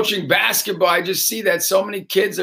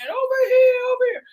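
put-on eager girl calling. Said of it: "one girl waving her hand".

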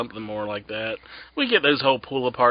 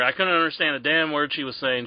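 usual speaking voice

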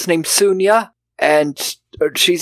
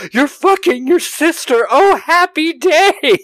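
higher, slow, overjoyed mock exclamation; a hammed-up jubilant dad; mock joyful dad; 11 semitones higher, slower than usual. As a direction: ham up the delighted father. Dad's overjoyed reaction, mocked.

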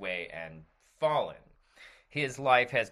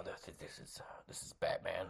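whispering; 4 semitones lower, 14 dB quieter than usual. Acted. Secret call, Batman whispers.

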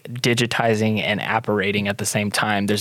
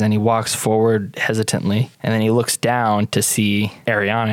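usual speaking voice

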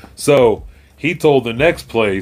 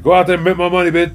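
high-pitched mock pimp ordering someone out; pimp named Slickback; 8 semitones higher, faster than usual. Pimp sending his creation out to earn, mock bossy. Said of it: "a pimp named Slickback".

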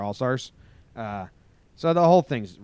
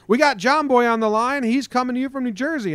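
much higher, louder hammed-up radio announcer; radio host intro; 13 semitones higher, 7 dB louder than usual. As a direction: introduce the caller like an over-the-top DJ. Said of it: "he's like".

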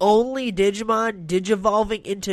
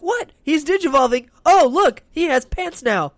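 high-pitched mock astonishment; amazed reaction; 9 semitones higher, 6 dB louder than usual. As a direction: exclaim in exaggerated, high-pitched wonder. Acting out amazed onlooker's reaction.

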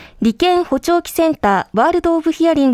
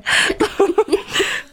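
breathy laugh; 7 semitones higher than usual. Real, not acted. Repeated explanation, embarrassed.

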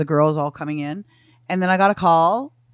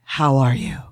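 low-pitched, weighty imitation of a doctor; doctor's grave greeting; 4 semitones lower, 3 dB louder, slower than usual. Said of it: "he goes like this".

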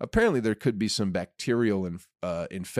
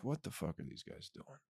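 much softer, muttering the question to himself; hushed baffled mutter; 18 dB quieter than usual. Retold or imitated. A neighbor staring at sheep-walkers, hushed and baffled. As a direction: mutter it under your breath, baffled. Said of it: "at first you'd be like".

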